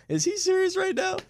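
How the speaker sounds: very high, incredulous aside; pitched-way-up mock amazement; mocking disbelief. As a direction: poke fun as a baffled onlooker. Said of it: "I would definitely be laughing to myself".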